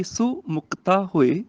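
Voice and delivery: slow verse, ਸੋ said as "su"; short "su". Shown as a demonstration. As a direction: say ਸੋ slowly with a short u. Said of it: "ਸੱਸੇ ਨੂੰ ਔਂਕੜ ਹੈਗੀ". (ਹੌਲੀ, ਸੋ ਨੂੰ "ਸੁ" ਬੋਲੋ)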